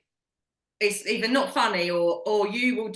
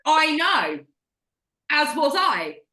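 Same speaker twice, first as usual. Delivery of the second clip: retold snappy comeback, higher and louder; mock annoyed retort; 8 semitones higher, 6 dB louder, slower than usual. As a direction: fire the joke back at him, mock-irritated. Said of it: "Now I'm fucking mad".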